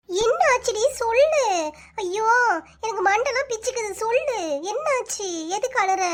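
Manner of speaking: high-pitched, exasperated pleading; worried, impatient, pitched high; frantic pressing. Acted. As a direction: press her anxiously to speak up. Friend frantically pressing the crying woman.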